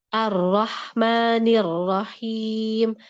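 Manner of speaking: Ar-Rahmanir Rahim very slow, breath streaming through the H; flowing H. Shown as a demonstration. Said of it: "jangan lupa alirkan".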